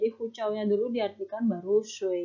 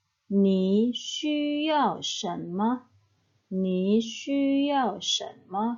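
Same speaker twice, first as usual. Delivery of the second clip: slow model sentence; much slower than usual. Shown as a demonstration. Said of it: "Yang ketiga".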